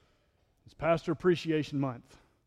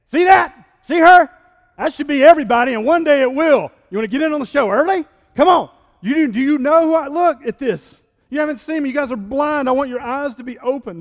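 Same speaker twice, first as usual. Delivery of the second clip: much higher, much louder fervent cry; Jesus voiced as a fiery preacher; impassioned shout; 11 semitones higher, 16 dB louder than usual. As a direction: preach it at full force, pleading. Jesus quote, preaching climax.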